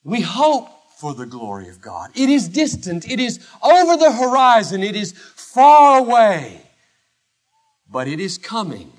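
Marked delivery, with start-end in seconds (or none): 2.1-6.6 booming pulpit emphasis; emphatic preaching. Acted. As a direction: paint the distance with your voice. Preaching at full force.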